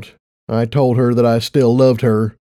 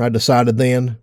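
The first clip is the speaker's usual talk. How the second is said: ordinary delivery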